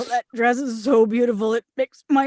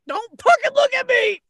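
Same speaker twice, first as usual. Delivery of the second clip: shrill embarrassed outburst; high, desperate yell; 11 semitones higher than usual. Acted. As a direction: scream to stop anyone looking. In mid-diarrhea.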